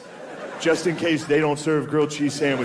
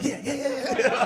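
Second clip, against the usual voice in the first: mock eager excitement; 11 semitones higher than usual. Kid asked to go for a walk, high excited yipping. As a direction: yip yeses like a dog offered a walk. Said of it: "Do you wanna go for a walk?".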